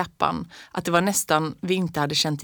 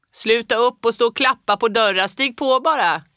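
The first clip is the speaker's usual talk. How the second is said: much higher and louder call to the door; shouting; 6 semitones higher, 5 dB louder than usual. A narrator's reading. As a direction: bellow it across the room. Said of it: "ropade Fritjof".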